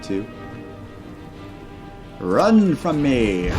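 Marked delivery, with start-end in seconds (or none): 2.2-3.5 slow, drawn-out roar of a taunt; loud slow taunt. Acted. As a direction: bellow it slowly at fleeing enemies. Werewolf attacking enemies, taunting.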